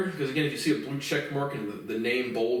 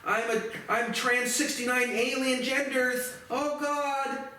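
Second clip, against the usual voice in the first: very high, whiny, panicked parody; squealing mock panic; mocking the left; 10 semitones higher, slower than usual. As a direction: shriek it like a hysterical activist. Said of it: "the left is like".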